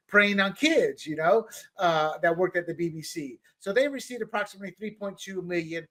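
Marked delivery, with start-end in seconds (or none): none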